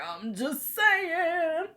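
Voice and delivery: high, slow and drawn out; sing-song tease. Real, not acted. Teasing her viewer about her tattoo, high and slow. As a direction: stretch it out teasingly.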